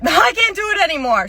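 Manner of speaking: high, loud exasperated cry; hammed-up end of patience; had it. Worker fed up with picking up debris, exasperated. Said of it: "Okay, I've had it".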